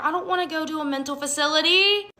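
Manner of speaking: high, rising mock protest; high-pitched replay of refusing to go; acted protest. Acted protest at being admitted.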